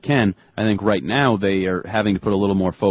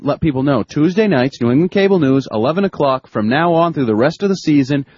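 upbeat, raised voice reading a fan email; email read aloud; 4 semitones higher than usual. Acted. Reads listener email.

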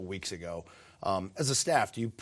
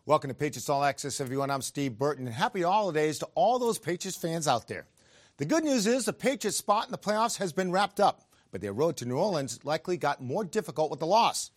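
bright, upbeat sports host read; TV host intro; 6 semitones higher, 6 dB louder than usual. Acted. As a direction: open the show like a TV anchor. Host opens the show.

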